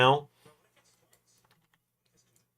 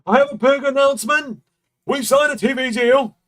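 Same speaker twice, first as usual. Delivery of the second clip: loud, high-pitched mock wrestling promo; Tony Khan promo voice; 7 dB louder than usual. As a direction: shout it like an over-the-top announcement. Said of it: "did his stupid promo voice he does".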